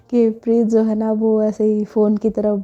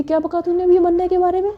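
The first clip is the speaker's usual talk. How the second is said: higher, fast jealous challenge; angry demand; 7 semitones higher, faster than usual. A narrator's reading. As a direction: confront her in a jealous rage. Jealous Preet confronts cousin. (जलन भरे गुस्से में तेज़ सवाल)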